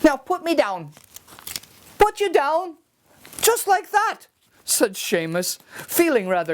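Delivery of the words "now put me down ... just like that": high, cagey little voice; sly leprechaun. Acted. Leprechaun denies his gold to Seamus in a high sly voice. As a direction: play innocent, high and quick.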